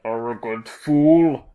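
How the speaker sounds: slow, loud monstrous snarl; dread snarl. Acted. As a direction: roar like a slamming crypt door. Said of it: "the dread apparition snarls".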